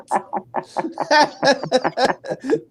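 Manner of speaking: high, sustained real laughter; long laugh. Real, not acted. Host amused his accent was pointed out, long laugh. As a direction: keep cracking up.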